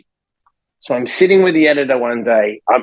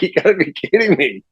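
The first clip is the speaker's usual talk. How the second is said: exasperated laugh in disbelief; 4 semitones higher than usual. Retold or imitated. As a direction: laugh it off, fed up. Covid again after everything.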